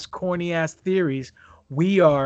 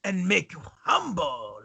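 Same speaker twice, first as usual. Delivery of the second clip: Iron Sheik voice. Pretend. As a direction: threaten like the Iron Sheik heel. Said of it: "in the Iron Sheik voice".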